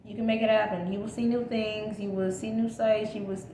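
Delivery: normal talking voice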